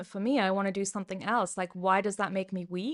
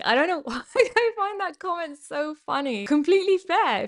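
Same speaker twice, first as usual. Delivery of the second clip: giggling through the words, pitched up; can't hold back a laugh; 7 semitones higher, 5 dB louder than usual. Real, not acted. Reading a funny comment to viewers, laughing.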